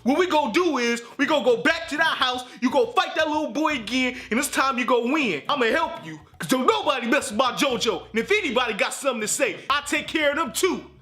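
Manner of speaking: rally the nephew, fired up; protective uncle. Retold or imitated. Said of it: "My uncle like".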